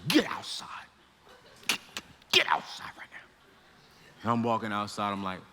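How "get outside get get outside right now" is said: whispered stern order; angry whisper. Retold or imitated. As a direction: hiss him out of the room. Teacher orders him out, whispering.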